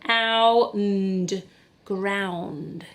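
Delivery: ordinary talk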